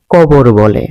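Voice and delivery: খবর said as কবর, unaspirated k; Netrokona dialect pronunciation. Shown as a demonstration. Teacher showing the Netrokona kh-to-k shift in কবর. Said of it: "ক উচ্চারণ করা হয়".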